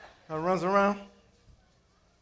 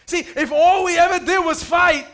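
preaching; 4 semitones higher than usual. Acted. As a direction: drive the point home to the church. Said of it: "this is preaching".

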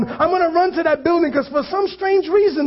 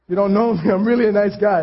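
ordinary delivery

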